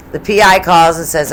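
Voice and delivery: usual speaking voice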